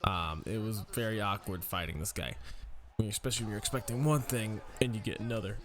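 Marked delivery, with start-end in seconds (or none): none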